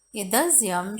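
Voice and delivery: ordinary delivery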